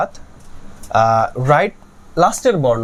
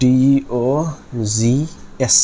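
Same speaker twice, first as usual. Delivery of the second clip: spelled out slowly; much slower than usual. Shown as a demonstration. Spelling out dogs while writing.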